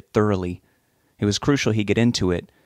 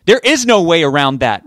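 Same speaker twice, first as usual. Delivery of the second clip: raised, louder and higher, in argument; angry yell; 7 semitones higher, 9 dB louder than usual. A narrator's reading. Rich refuses Rob's plan, yelling. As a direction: yell it to shut down the idea. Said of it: "Rich yelled at him".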